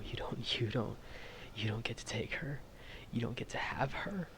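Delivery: breathy whispered pleading; a desperate whisper against death; whispered grief. Acted. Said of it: "crying openly and sobbing".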